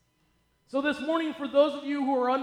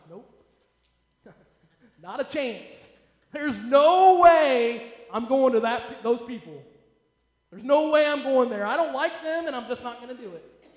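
stubborn refusal; 8 dB louder than usual. Retold or imitated. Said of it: "what was Jonah's response?".

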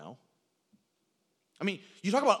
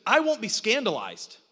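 loud, raised, emphatic declaration; bold vow; 5 semitones higher, 8 dB louder than usual. Retold or imitated. Peter insisting to Jesus he won't fall, bold vow. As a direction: protest it with full confidence. Peter boldly vows his loyalty.

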